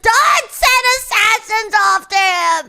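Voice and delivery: high, loud, slow pleading; frantic high-pitched begging. Real, not acted. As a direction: beg them loudly to spare him.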